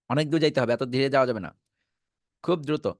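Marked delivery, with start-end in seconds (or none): none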